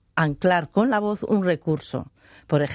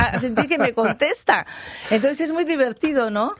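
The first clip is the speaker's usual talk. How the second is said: laughing; 6 semitones higher than usual. Real, not acted. Laughing about answering birds.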